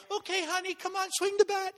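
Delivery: high, soft coaxing mom voice; lightly voiced sweet mom; a mom gently coaxing her son to swing; coaxing mother. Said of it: "Raymond's mother would come up and go".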